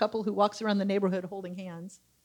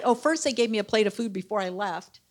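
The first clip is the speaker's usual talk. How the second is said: normal talking voice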